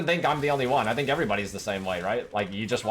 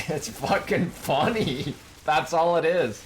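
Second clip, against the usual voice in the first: laughing; 5 semitones higher, slower than usual. Real, not acted. Laughing about streamer's rage reaction.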